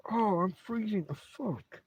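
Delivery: ordinary talk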